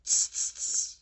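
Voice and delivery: loud hissed tss, tss, tss; mocking monkeys clicking back; monkey tsk. A narrator's reading. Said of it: "and said".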